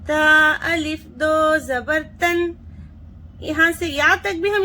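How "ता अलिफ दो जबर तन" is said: measured spelling-out chant; teacher's qaida recitation; reading aloud for students. Shown as a demonstration. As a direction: model each syllable for the class. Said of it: "इस तरह से भी हम पढ़ सकते हैं।".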